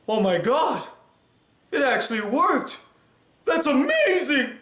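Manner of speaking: thrilled it actually worked; excited. Acted. Pill worked, young again.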